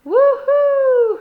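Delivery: very high, loud held hoot; crowd hoot. Acted. Crowd hoots at the trapeze, loud. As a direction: whoop like a thrilled crowd. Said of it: "hooted the crowd".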